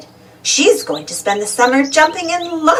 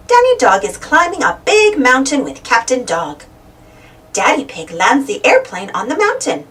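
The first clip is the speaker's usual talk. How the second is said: ordinary delivery, nothing marked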